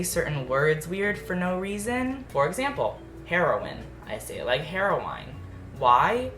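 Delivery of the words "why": usual speaking voice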